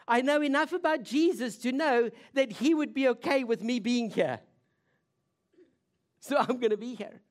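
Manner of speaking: acted insistence; bold resolve. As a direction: stand your ground, high and firm. Voices the woman's bold resolve.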